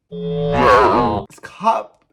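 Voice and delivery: loud, alarmed cry of discovery; alarmed outburst. Retold or imitated. Driver suddenly spots police on the right, alarmed outburst. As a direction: blurt it out loudly in mock alarm. Retold shock at spotting police.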